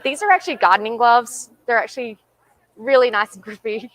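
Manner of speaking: ordinary talk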